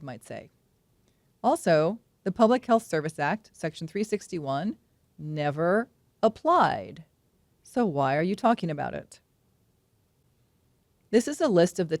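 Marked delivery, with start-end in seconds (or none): 5.2-7.0 never applied, drawn out slowly; slow emphatic. Real, not acted. Doctor dismissing the legal citation, slow and deliberate.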